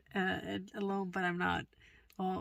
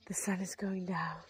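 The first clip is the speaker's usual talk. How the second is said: soft whispered remark; 3 semitones lower, 8 dB quieter than usual. Real, not acted. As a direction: hush it like the sun setting. Whispering at sunset.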